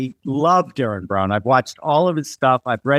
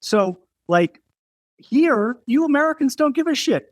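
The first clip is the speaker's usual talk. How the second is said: high-pitched, lightly imitated Brit; British designer; 12 semitones higher than usual. British designer telling an American what his countrymen ignore, lightly imitated. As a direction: retell her point well above your usual pitch. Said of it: "She goes".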